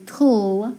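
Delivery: tul held alone as one syllable; syllable sound. Shown as a demonstration. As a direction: sound out tul, rhyming with pull. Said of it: "I know that's gonna say".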